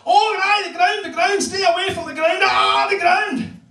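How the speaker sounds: put-on high, shrieking old granny panicking; mock-panicked granny cry; frantic granny. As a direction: squeal it like a terrified old woman. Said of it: "She would say things like".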